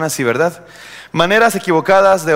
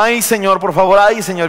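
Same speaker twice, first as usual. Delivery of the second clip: higher, mock pleading full of ays; plaintive wailing prayer; 4 semitones higher than usual. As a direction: imitate someone praying only in moans. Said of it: "los, los ayes". (súplica quejumbrosa, fingida)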